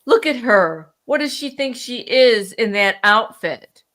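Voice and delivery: loud, scornful mock gossip; mocking judgmental voice. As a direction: sneer it like a busybody, loudly. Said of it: "Who said".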